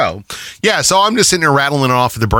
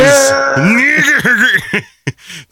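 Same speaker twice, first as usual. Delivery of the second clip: drawn-out laughing words; 14 semitones higher, much slower than usual. Real, not acted. Retelling a funny stranger encounter while laughing. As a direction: squeeze the words out through laughter.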